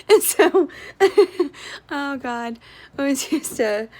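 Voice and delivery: words squeezed out through real laughter; giggling helplessly at herself; laughing. Real, not acted. Laughing at own old habit.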